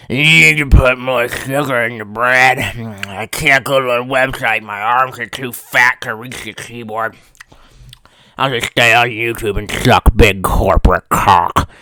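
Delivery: put-on low, slow dopey American accent; mock American voice. Mocking American viewers who won't switch websites, low and slow American drawl. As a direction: play a lazy dim-witted American in a thick drawl. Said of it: "my American voice".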